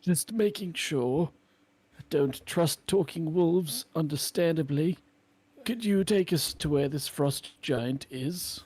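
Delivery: a hint of a cautious adventurer; lightly voiced wary hero. Hero answers wolf in character.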